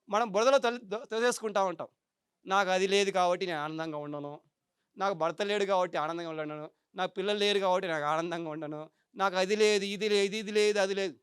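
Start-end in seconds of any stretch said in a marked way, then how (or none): 2.5-11.1 mock self-pity. Voicing self-pitying complainer.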